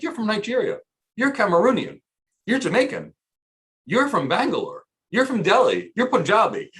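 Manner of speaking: high, singsong rise and fall on each guess; delighted guessing. Retold or imitated. Adviser placing callers by their accents, high and animated. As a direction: call out each origin like a quick spot-on guess.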